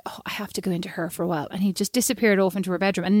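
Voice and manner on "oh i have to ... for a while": hushed, hurried mock excuse; retold hushed excuse. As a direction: imitate him in a near-whisper, quick and soft. Boyfriend excusing himself, retold.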